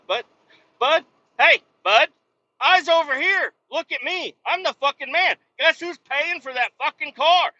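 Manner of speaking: high, loud furious rant at the dealer; ranting at the salesman who ignored him, yelling high and loud; retold furious yell. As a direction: tear into him, voice way up. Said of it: "I enjoy screaming".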